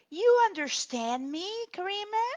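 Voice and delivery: mock the student's rising tune on "You understand me, Karime?"; rising question intonation. Shown as a demonstration. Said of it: "they just put the emphasis at the end".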